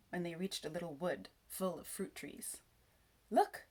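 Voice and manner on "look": high, loud excited exclamation. A narrator's reading. Peter spotting the fruit trees, excitedly. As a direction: point it out with sudden excitement. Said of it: "said Peter excitedly".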